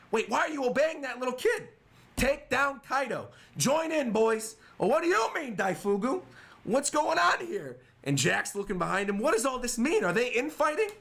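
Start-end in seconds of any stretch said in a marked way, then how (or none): 0.1-7.7 voiced characters. Voicing manga characters reading chapter. 9.2-10.9 confused villain. Retold or imitated. Voicing Jack confused.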